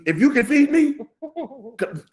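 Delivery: mock desperate call to his wife for help; hammed-up plea. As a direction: act the starving man begging to be fed. Comic plea, playing the hungry man.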